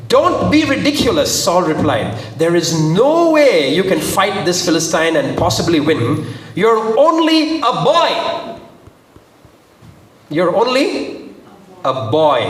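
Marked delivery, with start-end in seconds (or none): 0.1-1.4 high-pitched incredulous rebuke; dismissive protest. Acted. King scoffing at a shepherd boy's offer to fight, dismissive. 2.4-12.4 dramatic scripture reading. A narrator's reading. Preacher voicing Saul warning young David, dramatic and emphatic. Reads Saul's reply dramatically.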